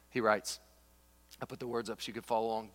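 ordinary talk

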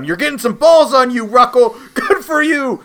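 high, loud hammed-up yell; mock scream. Retelling a screaming man yelling at a rival in a mock shout. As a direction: belt it out in a mock rage. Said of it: "Tobolowsky just screams at him".

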